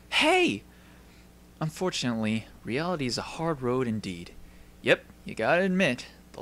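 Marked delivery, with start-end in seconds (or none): 0.1-0.6 excited call. Acted. Hero appears and calls out.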